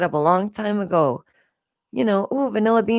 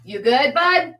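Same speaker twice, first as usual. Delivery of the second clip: tender check-in; 6 semitones higher, slower than usual. Real, not acted. Mother checks on her son.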